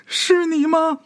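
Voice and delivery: high, calling into the dark courtyard; high plaintive call into the darkness; girl calling out. A narrator's reading. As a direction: call his name, searching and desperate. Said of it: "一遍又一遍的喊".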